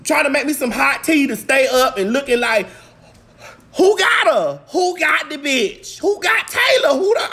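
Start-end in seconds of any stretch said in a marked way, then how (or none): 3.8-7.3 put-on high, urgent calls for the missing girl; hammed-up search-party call; searcher calling out. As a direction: play a searcher hollering round a campground. Acting out calling for missing Taylor.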